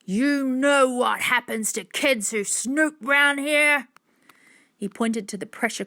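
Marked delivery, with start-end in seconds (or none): 0.1-3.9 loud, slow threat; raised, drawn-out intimidation; angry shouting man. A narrator's reading. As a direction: shout a menacing question at trespassing kids. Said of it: "The man didn't stop shouting".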